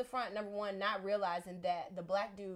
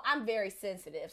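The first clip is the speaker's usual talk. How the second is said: ordinary delivery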